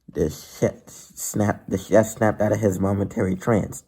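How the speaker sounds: ordinary delivery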